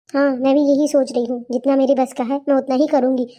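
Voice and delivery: normal talking voice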